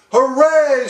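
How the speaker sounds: high, loud cheer; triumphant shout. A narrator's reading. King cheering his new height, loud and high. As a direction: whoop it from the top of the stack. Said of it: "shouted Yertle".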